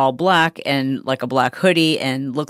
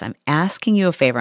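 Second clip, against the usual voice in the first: ordinary talk, nothing marked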